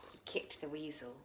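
usual speaking voice

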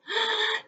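quick high intake of breath; wolf drawing breath to huff; sharp inhale. A narrator's reading. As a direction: suck in air to blow. Deep breath in.

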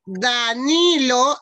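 Danilo drawn out very slowly with a rise; slow dictation. Shown as a demonstration. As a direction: stretch Danilo so the kids can write it. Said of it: "la primera palabra va a ser Danilo".

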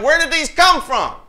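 high, loud mock bafflement; baffled outcry. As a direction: cry it out as a baffled John. Voices John's bafflement.

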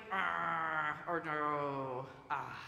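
drawn-out, put-on groan; mock dismay. Shown as a demonstration. Pastor recaps reaction to things we don't like, mock groan. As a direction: groan in playful disappointment. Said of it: "things we don't like".

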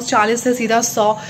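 ordinary talk

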